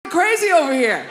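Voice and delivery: loud, high cry for help; desperate plea. Acted. Frazzled parent begging for help with a kid, desperate shout. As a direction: plead at the top of your voice.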